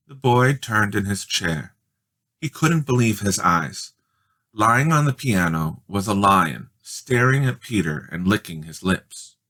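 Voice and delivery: ordinary delivery, nothing marked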